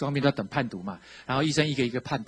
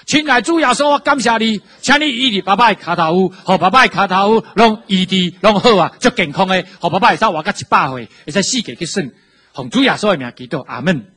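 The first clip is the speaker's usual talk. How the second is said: shouted, much higher Taiwanese prayer; loud prayer; 7 semitones higher, 13 dB louder than usual. Retold or imitated. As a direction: pray at full voice so a half-deaf father hears. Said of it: "我就很大声的祷告". (为半聋的爸爸大声用台语祷告)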